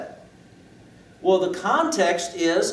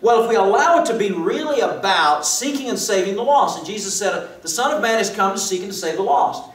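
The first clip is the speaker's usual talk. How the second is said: higher, louder proclamation; fervent preaching; 6 semitones higher, 5 dB louder than usual. Acted. Preacher proclaiming the lost are sought, fervent. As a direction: proclaim it with rising passion.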